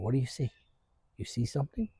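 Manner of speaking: calm the whining dog down softly; hushed dog talk. Real, not acted.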